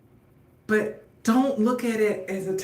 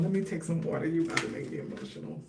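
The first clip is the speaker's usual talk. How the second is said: muttered softly under her breath, much softer and lower; soft aside to herself; 4 semitones lower, 10 dB quieter, much faster than usual. Real, not acted. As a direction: say it quietly to yourself, half turned away from the mic.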